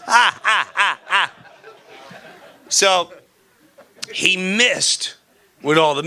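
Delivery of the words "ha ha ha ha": mock laugh. Mock villain laugh after punchline.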